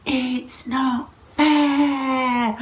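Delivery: drawn-out angry whine, each word stretched; fiery protest. Acted. Storyteller acts the fiery dragon losing a game, angry protest. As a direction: protest it like a child boiling over, very slow. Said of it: "he started getting fiery".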